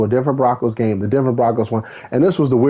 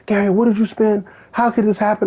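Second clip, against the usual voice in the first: mock accusing fans; 10 semitones higher than usual. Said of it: "They're like".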